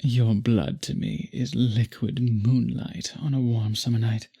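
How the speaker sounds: ordinary talk, nothing marked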